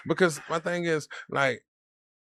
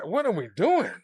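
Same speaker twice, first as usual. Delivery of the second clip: high-pitched mock disbelief, rising at the end; acted-out bafflement; 8 semitones higher than usual. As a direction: replay a baffled protest. Repeats acted baffled reaction.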